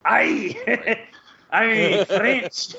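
French attempt sliding into Scottish, drawn out with laughs; badly faked French turning Scottish; botched French accent. Host fumbling the French, botched French-Scottish accent. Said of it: "Maybe that was more Scottish".

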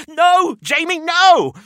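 high, loud cry of no; desperate shout. A narrator's reading. Narrator shouting as Jamie tips the head, desperate cry. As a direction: yell to stop him in panic. Box tipping, narrator cries out.

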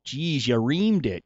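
slow, drawn-out Minnesota accent. Shown as a demonstration. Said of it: "asking me to do the Minnesota accent".

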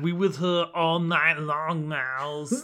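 slow, hammy fake German accent. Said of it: "fake German accent".

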